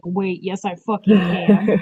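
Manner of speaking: slow, loud, defiant; defiant shout. Retold or imitated. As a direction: belt it out in triumph. Retold defiant thought.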